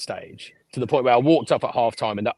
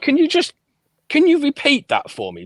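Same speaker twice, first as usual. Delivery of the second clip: very high, squeaky disbelief; stunned high-pitched request; 5 dB louder than usual. Retold or imitated. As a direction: ask in shocked disbelief. Stunned question to producers.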